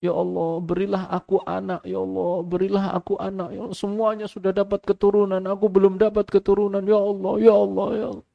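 high, pleading, tearful voice, mildly imitated; weeping prayer. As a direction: beg God for a child through mock tears. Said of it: "berdoa dengan menangis-nangis". (doa sambil menangis, ditirukan)

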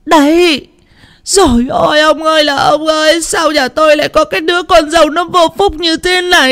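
high, shouted theatrical lament; loud wailing. Acted. Mother-in-law bawling about her daughter-in-law, high and loud. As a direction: bawl to the heavens. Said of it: "bù lu bù loa".

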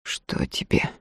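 hushed, choked-off whisper; strangled whisper. Acted. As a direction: force it out in a strained whisper. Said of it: "сдавленно спросил он".

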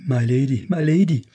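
insistent, anxious appeal; urgent plea. A narrator's reading. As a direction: call out to her, pleading. Servant pleads with her lady.

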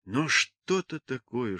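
plain reading voice

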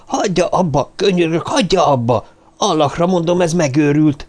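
exasperated, urgent cry; desperate pleading. Acted. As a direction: beg her frantically to stop. Karahan begs screaming woman to stop.

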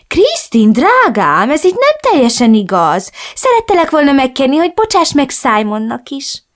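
a hint of a reproachful mother. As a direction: reproach her daughter in a lilting mother voice. Mother voiced in story.

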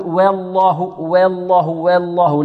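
"والله" بواو ممالة نحو الكسرة; أمل فتحة واو "والله" غلطًا; مبالغة في الترقيق. Shown as a demonstration. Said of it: "لا نبالغ في ترقيقها حتى تمال". (بالغ في ترقيق واو "والله" حتى تميل)

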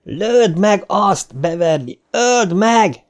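very high, drawn-out frantic cry; urging a kill in high-pitched hysteria; hysterical yelling. A narrator's reading. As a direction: yell it slowly in a high, hysterical voice. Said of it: "mögöttük Ricsi ordibált hisztérikusan".

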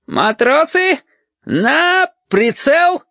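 very high, drawn-out military order; shouted command. A narrator's reading. Lieutenant orders sailors to take aim, shouting. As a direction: cry the order high, stretching the words. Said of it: "Хариус крикнул".

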